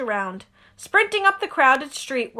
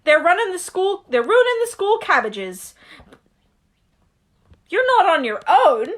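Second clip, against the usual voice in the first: higher, louder lightly voiced giant; Hagrid voice; 7 semitones higher, 4 dB louder than usual. Hagrid grumbling to Harry, lightly voiced. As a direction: keep the rough giant going.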